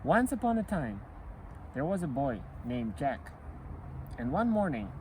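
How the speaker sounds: ordinary delivery, nothing marked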